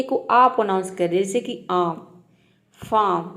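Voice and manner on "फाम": फार्म as "faam", no r; long aa straight into m in फार्म; silent r. Shown as a demonstration. Teacher listing examples for students, फार्म without r. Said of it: "आर को silent रखना है".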